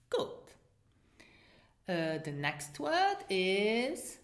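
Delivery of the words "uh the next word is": ordinary talk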